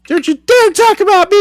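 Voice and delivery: very high, loud falsetto outrage; [name] voice. Pretend. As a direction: shriek it in falsetto.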